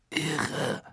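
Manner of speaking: soft, high, breathed word; hushed wonder; awed hush. Acted. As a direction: murmur it in amazement. Lee's awed one-word verdict.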